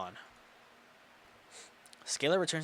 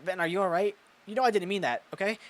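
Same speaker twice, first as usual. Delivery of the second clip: nervous apology; 7 semitones higher, 6 dB louder than usual. Acted. Mia apologising to hurt Ben.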